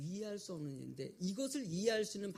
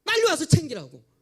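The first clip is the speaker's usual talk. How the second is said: loud, much higher furious rebuke; thunderous scolding; 9 semitones higher, 17 dB louder than usual. Retold or imitated. Mother-in-law wakes her sleeping daughter-in-law, roaring scolding. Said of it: "호통을 치듯이".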